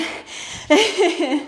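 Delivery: light, breath-filled chuckle; amused laugh. Real, not acted. Coach joking about botching the combo, amused laugh. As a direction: chuckle at your own likely mistakes.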